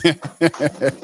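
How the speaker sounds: loud high burst of real laughter. Real, not acted. Laughs at butterflies comment.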